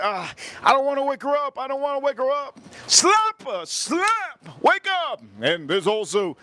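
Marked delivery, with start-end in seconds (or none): 0.0-2.6 high, hesitant whiny mock voice; hammed-up meek whine, pitched up; mock timid date. Mocking a timid man on a date. 2.9-5.2 over-the-top comic shout. Acted. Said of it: "I went off the Richter scale there".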